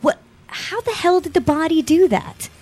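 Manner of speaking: high-pitched, astonished question; amazed disbelief. Acted. Host acting out someone seeing the rash, amazed disbelief. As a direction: marvel in baffled wonder. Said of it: "you look at this on someone's body and you're like".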